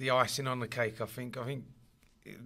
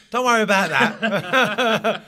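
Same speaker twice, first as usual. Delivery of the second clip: laughing mock reassurance; 7 semitones higher, 11 dB louder, much slower than usual. Manager reassuring new signing.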